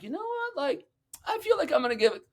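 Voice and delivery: high-pitched mock musing; put-on high voice for a hypothetical thinker; imagined helper. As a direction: play someone having an unlikely bright idea, high. Acts imagined person's idle thought.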